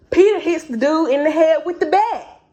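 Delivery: normal talking voice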